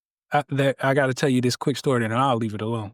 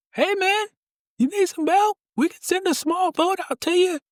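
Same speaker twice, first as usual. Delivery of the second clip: much higher, eager voice hailing the raft; big ship rescuer. Acted. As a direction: offer the small boat warmly. Joke: big ship offers help.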